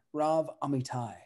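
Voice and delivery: ordinary delivery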